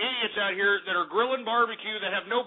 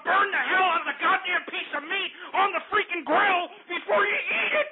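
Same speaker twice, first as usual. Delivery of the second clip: angry shout; 9 semitones higher than usual. Real, not acted. Ranting at bad grillers, building to a high furious shout. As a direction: voice climbing into a furious yell. Ranting about burnt barbecue.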